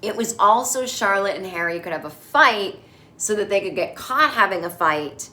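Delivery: normal talking voice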